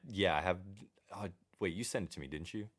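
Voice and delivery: ordinary delivery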